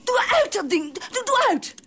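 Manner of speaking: sharp, rising order to switch it off; angry command. Acted. Wife angrily orders husband to turn recorder off. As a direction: demand it, pitch climbing.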